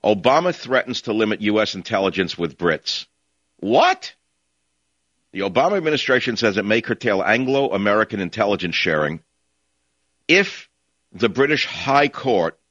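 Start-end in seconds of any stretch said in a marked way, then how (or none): 3.6-4.1 sudden high squeak of disbelief; what, shooting way up in shock. Real, not acted. Radio host stunned by a news headline, high squeaky disbelief. 11.1-12.6 slow, with High leaned on hard; stress on High. Shown as a demonstration. Host mocking the court's name, slow stress on High. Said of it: "emphasis on the middle word".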